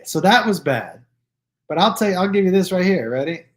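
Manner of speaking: ordinary talk, nothing marked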